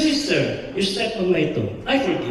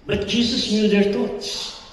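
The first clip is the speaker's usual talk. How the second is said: normal talking voice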